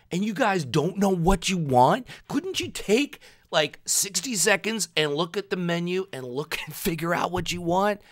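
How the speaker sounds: loud, high, fed-up outburst; exasperated rant. Retold or imitated. Ranting at the dithering couple in line, loud exasperated voice. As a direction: vent your impatience at the couple, loud and high. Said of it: "I'm like".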